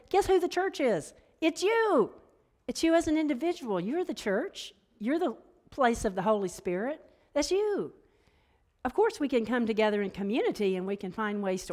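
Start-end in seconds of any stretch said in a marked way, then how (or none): 0.1-7.9 higher, fervent preaching; impassioned higher preaching; rising preaching. Acted. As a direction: lift the pitch and proclaim it. Preaching Fillmore's message.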